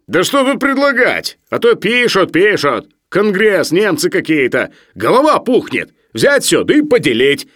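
high, loud grumbling; Sharikov, boorishly opinionated. Acted. Sharikov airs his opinion at dinner, loudly aggrieved. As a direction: complain as if it is all obvious.

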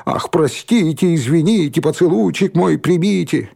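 high, sing-song jeering; mocking taunt. A narrator's reading. Bully mocking from behind fence.

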